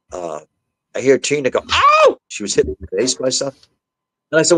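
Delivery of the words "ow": very high, loud mock yelp; piercingly high "ow", acted out; imitated pain cry. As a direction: re-enact a sudden cry of being hit. Wife heard being hit, retold.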